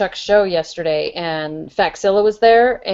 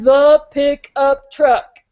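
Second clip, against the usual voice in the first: very slow and louder, exasperated; slow exasperated answer; 5 semitones higher, 5 dB louder, much slower than usual. Retold or imitated. The spirit correcting her, slow, loud and fed up. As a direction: spell it out slowly like to someone who won't listen. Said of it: "he was like".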